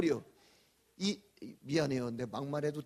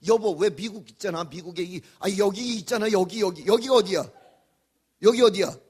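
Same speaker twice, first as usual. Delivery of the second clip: lightly voiced flustered wife, louder; wife asking; 3 semitones higher, 10 dB louder than usual. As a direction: imitate the wife groping for a word. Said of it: "그러는 거".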